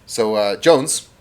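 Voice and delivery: ordinary delivery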